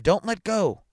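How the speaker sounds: high, loud urgent cry; shout. A narrator's reading. A shout from the timber yard, loud and urgent. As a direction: shout it over a yelping dog. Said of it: "a shout of".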